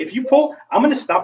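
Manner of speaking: high, pointed warning to the dog. Shown as a demonstration. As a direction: spell out the rule to the dog. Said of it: "I'm using that tone".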